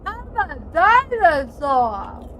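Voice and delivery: slow, high, loud dino voice; goofy dinosaur. Pretend. Player roleplaying as a dinosaur, in a slow booming dino voice. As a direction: bellow it like a dopey dinosaur.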